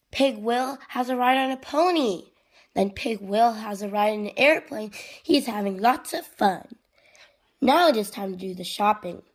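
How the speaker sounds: lively, projected picture-book reading; children's story narration. A narrator's reading. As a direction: read it animatedly to little kids. Reads storybook aloud to children.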